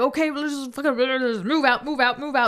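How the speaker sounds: lightly voiced hurried army orders; army men. Army men descend on the group, lightly voiced urgent orders. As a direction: rattle off mock military orders.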